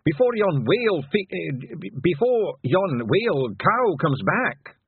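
high Scots voice, stumbling and correcting himself; falter, then finish slowly with a brogue; nervous pixie. A narrator's reading. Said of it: "holding up a nervous hand".

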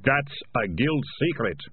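slow, emphatic rebuke in character; snapping. A narrator's reading. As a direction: snap it at an accomplice, each word weighted. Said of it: "Ratcatcher one snapped at him".

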